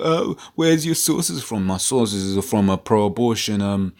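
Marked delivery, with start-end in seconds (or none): 0.0-1.6 high, slow mock voice of a doubter; higher, slower sneering imitation of a critic. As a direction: ham up a whiny skeptic demanding sources. Mocking critics who ask for sources.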